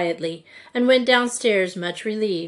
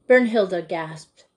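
usual speaking voice

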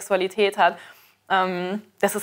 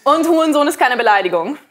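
giggling, louder and higher; stifled giggles; 5 semitones higher, 4 dB louder than usual. Real, not acted. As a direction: laugh through the comeback. Laughing at Onkel Detlef comment.

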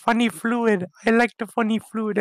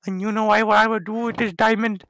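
ordinary delivery

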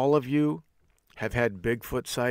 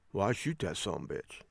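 low, soft matter-of-fact guy; understated, no second thoughts; mock unhesitating guy; 3 semitones lower, 8 dB quieter than usual. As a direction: imitate a deadpan local student. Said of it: "with no hesitation whatsoever".

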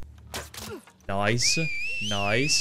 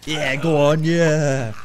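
loud, higher urging yell; roused shout of approval; triumphant cheer; 4 semitones higher, 7 dB louder, slower than usual. Real, not acted. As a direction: cheer on your fighter. Cheering a kill in game.

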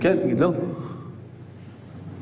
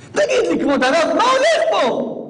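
mock complaint; 8 semitones higher, 3 dB louder than usual. Mocking the sister's complaint.

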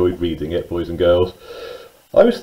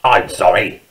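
brusque storybook badger voice; barking badger; 3 semitones lower than usual. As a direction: bark it out like a gruff badger. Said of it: "barked Badger".

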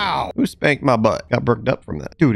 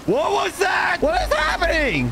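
high frightened shout; panicked shout. Real, not acted. Player spooked by staring villagers, panicked high shout. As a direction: yell it out, spooked and lost.

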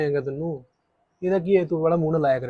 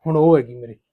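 angry retort; 2 semitones lower, 6 dB louder than usual. Acted. Husband retorts in quarrel.

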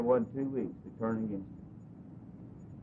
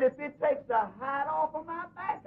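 higher, slow, fervent pledge; earnest vow; 14 semitones higher than usual. Retold or imitated. Pastor swearing to Brother Bob he wants the truth, high and slow. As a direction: stretch it out high like a solemn vow.

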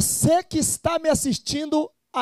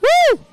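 high, loud whoop; excited hype cry; 14 semitones higher, 6 dB louder than usual. Real, not acted. Host cheers on the audience, high whoop.

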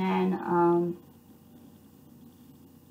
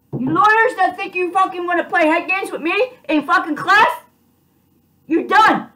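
furious rant; 5 semitones higher than usual. Real, not acted. Raging at the judge, prosecutor and lawyers, shouting. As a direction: spit it at them, building to yells.

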